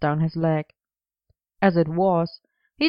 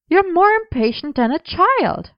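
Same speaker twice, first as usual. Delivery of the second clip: higher, mock accusation; accusing; 12 semitones higher, 3 dB louder than usual. A narrator's reading. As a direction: scold him like a teasing friend. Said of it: "Gwen had accused him".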